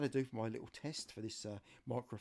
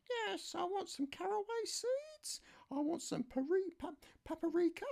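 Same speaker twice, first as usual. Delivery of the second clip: much higher falsetto voice for his niece; niece's voice. Retold or imitated. Niece phoning her uncle for help, high falsetto. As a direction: play the niece asking a favour, pitched way up. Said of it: "I've got wrong voice".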